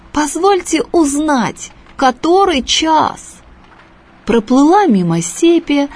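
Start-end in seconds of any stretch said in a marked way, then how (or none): 0.1-3.4 slow, higher courteous ask; polite question. A narrator's reading. The langouste politely asks the boy, slow and higher. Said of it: "вежливо спрашивает".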